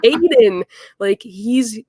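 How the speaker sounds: high, loud burst that settles; thrilled cry of recognition; fangirl excitement. Retold or imitated. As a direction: blurt it out to your startled dad. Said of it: "I screamed".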